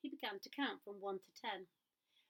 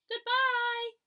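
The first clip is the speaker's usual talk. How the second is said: high, loud long call; little girl calling goodbye across the path; 14 semitones higher, 12 dB louder than usual. A narrator's reading. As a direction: call after him. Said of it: "called the little girl".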